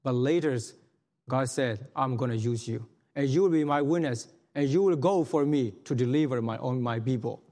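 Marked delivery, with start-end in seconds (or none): none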